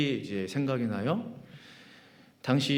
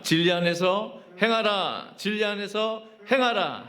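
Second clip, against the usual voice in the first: high, raised chanting call; rallying slogan shout; 10 semitones higher than usual. Real, not acted. Pastor leads congregation in the vision slogan, chanted cry. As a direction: call out the church motto, drawing out the last word. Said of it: "우리 큰 목소리로 외쳐보도록 하겠습니다".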